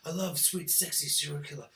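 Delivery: hushed, quick lovestruck Dexter; mock lovesick Dexter. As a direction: murmur it like a besotted fool. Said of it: "And Dexter's like".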